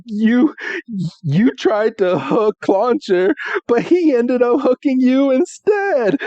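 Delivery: high, gleeful teasing of a friend; mock taunting. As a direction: rub it in with a high, playful jeer. Acts out Ash gloating at Misty.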